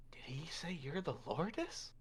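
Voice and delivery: hushed, Lourdes rising; whisper. Acted. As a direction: close whispered question. He gets real close.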